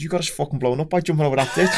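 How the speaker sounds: laughing retelling. Retold or imitated. Laughing while telling his mate he caused the blast. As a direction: break into laughter as you deliver the news.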